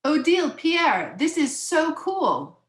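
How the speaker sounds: high, drawn-out excitement; storybook child full of wonder; delighted child. Acted. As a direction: gush like a thrilled little girl.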